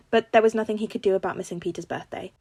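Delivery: usual speaking voice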